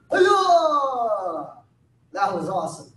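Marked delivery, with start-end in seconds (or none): 0.1-1.5 high, drawn-out kiai; long falling martial arts cry; loud yell. Real, not acted. Instructor leaping into sitting stance with a long high yell. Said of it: "for that loud yell".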